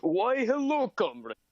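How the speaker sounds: heavy Russian sunflower voice; gloomy Russian comrade accent. Acted. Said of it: "Why are you making, like, a voice".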